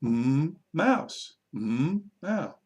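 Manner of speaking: hummed "Mm", pause, then "mouse"; sound then word. Shown as a demonstration. Teacher matching sound to word, "Mm" held before "mouse". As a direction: set "Mm" apart from "mouse", lilting. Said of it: "just go".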